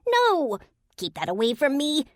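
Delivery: very high, loud panicked yelp; high panicked fairy. A narrator's reading. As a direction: recoil from the potion in panic.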